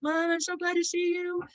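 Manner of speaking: high-pitched, gleeful dog greeting its mom; happy dog. Acted. Excited dog greeting its owner at the door, happy high voice. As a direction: squeal it like an adoring pet. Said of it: "the dog is so happy to see you".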